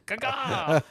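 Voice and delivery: high-pitched caw; high, playful eagle cry; mock bird call. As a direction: squawk it like a bird.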